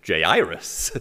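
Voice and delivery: "Jairus" as jay-EYE-rus, three syllables; actor's pronunciation. Retold or imitated. Preacher quotes a recorded Bible reader, three-syllable pronunciation. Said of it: "And he says".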